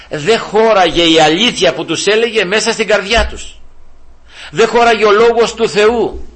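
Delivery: high, loud pulpit voice; preaching at full force; fiery preaching. Acted. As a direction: rouse the congregation.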